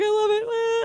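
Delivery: very high-pitched gushing; gushing fan. Retold or imitated. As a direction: squeal it like a starstruck fan. Acted gushing to the band.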